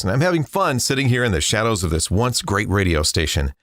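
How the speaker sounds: ordinary talk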